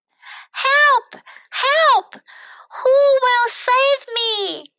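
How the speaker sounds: very high, drawn-out damsel cries; princess crying for help. A narrator's reading. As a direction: call out theatrically for a rescuer. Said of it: "cried the pretty princess".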